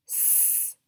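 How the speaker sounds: S-W-E-T as four separate sounds, long gaps; tapped sound by sound. Shown as a demonstration. Teacher tapping out sweat, S-W-E-T sounded in pieces. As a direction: sound out S-W-E-T one sound at a time. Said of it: "Let's tap it".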